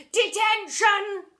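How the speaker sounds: slow, loud booming call; deputy head booming a punishment. A narrator's reading. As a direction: boom the word out. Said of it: "she boomed again".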